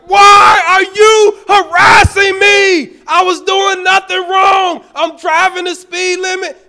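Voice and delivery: high, loud furious yelling at the cop; furious shout. Retold or imitated. Pastor yelling at the police officer, furious shout. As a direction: bellow it like the Hulk unleashed. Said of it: "I turned into the Incredible Hulk".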